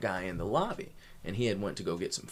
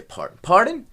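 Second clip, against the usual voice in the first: much higher and louder, demanding; indignant pardon; 14 semitones higher, 14 dB louder, slower than usual. Retold or imitated. Pushing back at the owner's wife, loud and high. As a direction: fire it back, outraged. Confronting the wife's joke.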